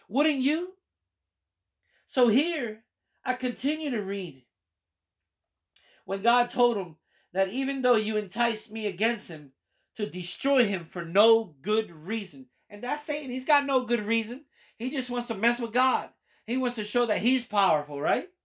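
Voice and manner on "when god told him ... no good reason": normal talking voice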